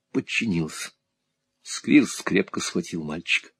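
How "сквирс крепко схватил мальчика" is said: plain reading voice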